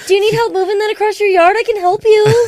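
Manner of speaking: high, quick helpful-neighbour voice; eager polite quokka. Acted. Voicing a quokka offering yard help, high eager politeness.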